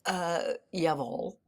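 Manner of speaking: put-on a slow German jawohl, lightly accented; German reply. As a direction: answer crisply in German, slow. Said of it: "And I said".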